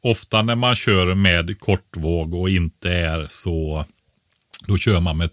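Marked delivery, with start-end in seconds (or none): none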